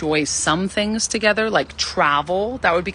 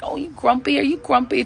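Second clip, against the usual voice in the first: put-on baby-talk teasing; 7 semitones higher than usual. Teasing grumpy ex-husband.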